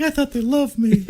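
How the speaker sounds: high, laughing mock whine; mock self-pity. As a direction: pout it out like a wounded star. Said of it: "It's like".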